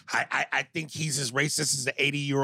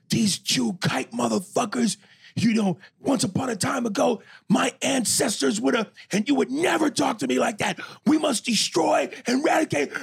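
imitated raging rant; 10 semitones higher, 4 dB louder than usual. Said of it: "he is yelling at the top of it".

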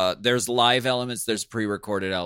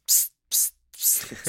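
short hissed squirts; repeated unvoiced psst bursts; spray bottle noise; 4 dB quieter, slower than usual. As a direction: imitate spritzing from a spray bottle. Imitates bleach spray bottle.

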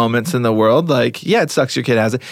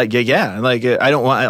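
ordinary delivery

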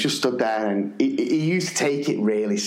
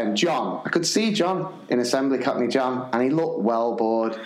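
normal talking voice

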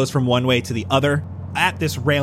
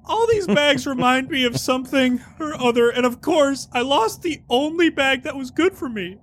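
high character voice; 10 semitones higher than usual. Acted. High-pitched bag NPC.

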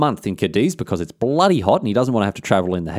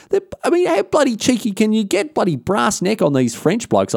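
indignant, much higher pitch; 9 semitones higher than usual. Real, not acted. Narrator to listeners about the cheeky French, indignant.

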